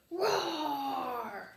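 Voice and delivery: shouting roar breaking into a laugh; loud roar. A narrator's reading. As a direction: roar with all your might. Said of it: "roared as loud as I could".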